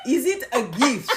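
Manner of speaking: slow mock-French accent on a question; playfully attempted French accent. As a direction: ask in a badly faked French accent, amused. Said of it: "attempt to do the French accent".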